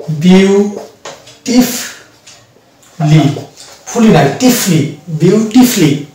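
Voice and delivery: বিউটিফুলি drawn out slowly as biu-ti-fli, no ful; correct pronunciation. Shown as a demonstration. Teacher showing students বিউটিফুলি, slow and careful. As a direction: stretch বিউটিফুলি, ending tifli not tifuli. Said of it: "আমরা বলি বিউটিফুলি".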